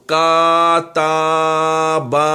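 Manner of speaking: کاتابا with every zabar stretched into a long aa, very slowly; wrong stretched vowels. Shown as a demonstration. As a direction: drag each vowel of کاتابا out as a wrong example. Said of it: "تو وہ بھی مجہول ہے".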